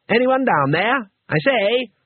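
high calling out from above; a Two Flower shouting down the cliff; called down. A narrator's reading. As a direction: call down from the hole. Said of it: "shouted the wizard".

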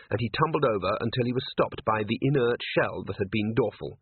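usual speaking voice

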